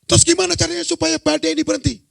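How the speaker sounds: fast, high, loud demand; panicked shouting. Acted. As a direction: shout it urgently over a storm. Penumpang panik menanyai Yunus saat badai. (berteriak panik, cepat dan tinggi)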